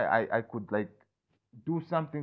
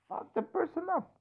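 imitated impulse. Voicing his own impulse.

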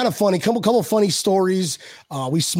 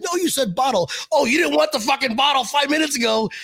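higher, half-joking indignant shout; mock outraged shout; 8 semitones higher than usual. Host retells player teasing about the bottle, mock shout. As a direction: imitate a player's mock-angry outburst.

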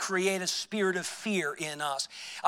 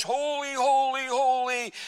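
high-pitched sing-song chant of holy; taunting singsong, pitched way up; high sing-song mocking; 6 semitones higher than usual. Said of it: "in a real high-pitched sing-song voice".

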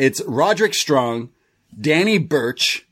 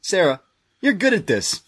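light, higher breezy voice; breezy quote; 7 semitones higher than usual. Retold or imitated. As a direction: toss it off to Sarah like a cocky star. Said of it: "he tells Sarah".

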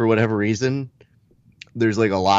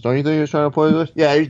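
higher, fast fighter-bro back-and-forth; Diaz brothers; 7 semitones higher, faster than usual. Acted. Brothers agreeing they were poisoned, fast higher impression. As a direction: rush it as two stoner brothers agreeing. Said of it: "the Diaz brothers".

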